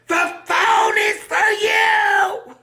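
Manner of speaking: screaming at Larry. Retold or imitated. Person at home yelling that the call is for Larry. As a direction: drag it out in a frantic holler.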